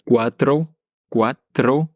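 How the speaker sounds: "cuatro" with a tapped r in tro; rolled r. Shown as a demonstration. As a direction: roll the r in "cuatro". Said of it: "on a ce tro qu'on retrouve".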